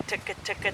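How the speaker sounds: "ticka, ticka" spoken softly, crisp; soft clipped ticka syllables. Shown as a demonstration. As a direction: tap out "ticka" lightly with the tongue. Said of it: "saying something like ticka".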